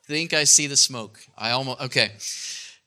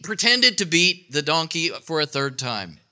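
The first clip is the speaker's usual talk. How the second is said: ordinary talk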